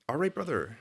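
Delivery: tentative, slowed-down catchphrase delivery; unsure, understated quote of a sketch line; said quietly. Shown as a demonstration. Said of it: "Why do you say it so quiet?".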